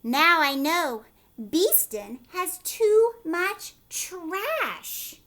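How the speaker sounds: loud, drawn-out announcement; loud slow proclamation. Acted. Mayor Hippo loudly announcing the answer to his town. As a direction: proclaim the discovery slowly.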